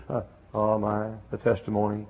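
normal talking voice

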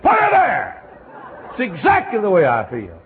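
very high, loud exclamation; delighted man. Retold or imitated. Chemist agreeing with the preacher, very high and loud. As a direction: burst out in delight.

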